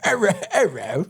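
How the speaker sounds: giggling through a mock Australian accent; laughing mock Australian. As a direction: laugh while playing Australian. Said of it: "I can't even do an Australian accent".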